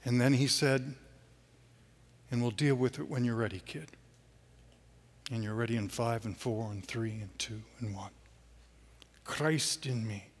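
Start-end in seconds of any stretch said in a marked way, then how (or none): 2.3-10.3 gentle countdown. Retold or imitated. Jesus counting him down gently.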